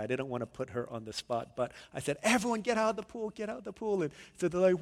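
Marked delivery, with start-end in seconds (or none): 2.2-4.0 raised, high-pitched call to clear out; urgent order. Retold or imitated. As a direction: call everyone out urgently, short of screaming. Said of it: "there was urgency".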